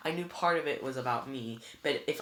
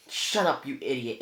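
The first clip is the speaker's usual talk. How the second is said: angry growl; 7 dB louder than usual. A narrator's reading. Bear twin silencing his laughing brother, angry growl. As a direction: snap at him with contempt. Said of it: "Agrias growled".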